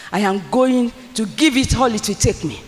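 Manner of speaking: ordinary delivery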